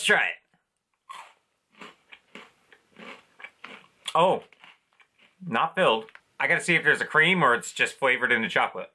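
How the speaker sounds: speaking between bites; chewing while talking. Real, not acted. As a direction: mouth partly full. Seen 1: Bites at -4 and 5.1, then chews while talking; face later hidden.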